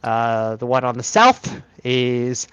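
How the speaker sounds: normal talking voice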